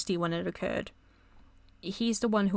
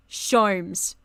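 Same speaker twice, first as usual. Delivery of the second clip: flat, firm call, louder still; insistent loud call; 7 dB louder than usual. Real, not acted. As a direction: call his name out firmly and loud. Calling to Herlock more insistently.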